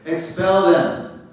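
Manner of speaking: loud, slow, stern order; forceful slow command. Retold or imitated. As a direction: bark it out slowly like a guru's decree. Prabhupada's command quoted.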